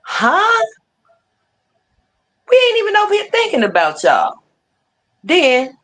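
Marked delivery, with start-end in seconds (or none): 0.1-4.3 high, disbelieving huh; retorting in disbelief to the rivals; incredulous huh. Retold or imitated. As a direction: act baffled at the accusation. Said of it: "I'm looking like".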